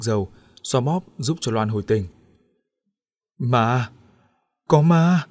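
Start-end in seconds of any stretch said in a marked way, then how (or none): 3.4-5.3 building to a loud, high terrified cry; terrified stammer. A narrator's reading. Wife waking from a faint, crying out in terror. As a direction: blurt it out in terror. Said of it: "Loan đã lắp bắp nói".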